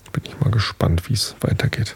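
whisper. Real, not acted. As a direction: whisper it as an aside. Aside to listeners.

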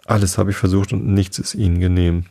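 ordinary delivery

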